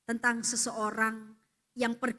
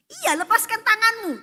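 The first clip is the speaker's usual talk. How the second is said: insistent command; 11 semitones higher, 12 dB louder than usual. Acted. God repeats command insistently.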